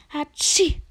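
high, loud raven croak; cawing croak. Acted. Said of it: "krächzte einer der Raben".

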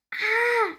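very high startled shriek; frightened shriek. A narrator's reading. Fairy sees berries turn brown, high frightened shriek. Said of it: "kreischt Erdbeerinchen erschrocken".

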